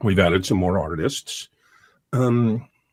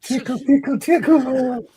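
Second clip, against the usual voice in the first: very high, loud squeaky voice; squeaky tickle voice; 3 dB louder than usual. Acted. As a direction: squeal it like a playful cartoon tickler. Playful tickle call repeated.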